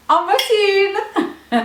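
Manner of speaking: very high, slow, stretched squeal; excited gushing. Real, not acted. Showing viewers a demijohn, high excited gush. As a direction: present it in a high delighted squeal.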